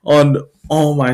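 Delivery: ordinary delivery, nothing marked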